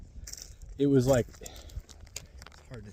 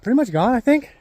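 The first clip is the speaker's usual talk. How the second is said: excited; 11 semitones higher, 8 dB louder than usual. Real, not acted. Upbeat report.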